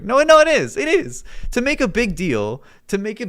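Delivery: high, loud insistent protest. Real, not acted. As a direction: cut him off, loud and high. Heated argument with chat.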